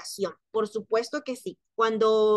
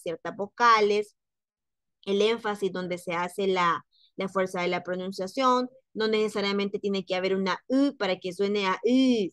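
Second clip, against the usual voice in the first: ordinary delivery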